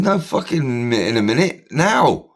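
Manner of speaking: insisting it happens right now; angry retort. Retold or imitated. Retold row with the orderly.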